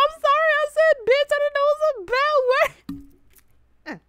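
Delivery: very high, loud wail, drawn out at the end; mock wailing apology. Child confessing from under the car, mock wailing. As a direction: squeal the apology like a frantic child.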